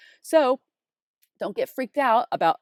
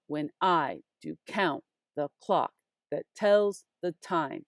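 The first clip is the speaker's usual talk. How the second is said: "When I do count the clock that tells the time" in measured pairs, heavy on every second word; deliberate metrical reading, stressing "I", "count", "clock", "tells", "time"; soft-heavy meter; 3 semitones lower than usual. Shown as a demonstration. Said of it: "and it's soft, heavy".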